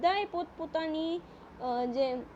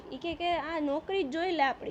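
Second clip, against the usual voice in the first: ordinary delivery, nothing marked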